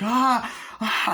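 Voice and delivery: high imitated shriek; mock frightened scream. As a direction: yelp high like a horror-film victim. Imitates film victim being attacked.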